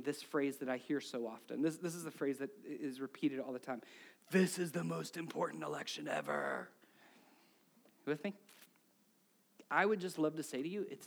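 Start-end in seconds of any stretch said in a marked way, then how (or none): 4.3-6.7 low, droning mock proclamation; mock solemn. Preacher repeats a political phrase heard all the time, mock solemn. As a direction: intone it like a tired slogan.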